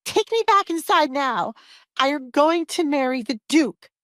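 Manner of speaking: high-pitched yelled demand; yelling. A narrator's reading. Alessandra to father while pinned down, yelling. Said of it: "she yelled".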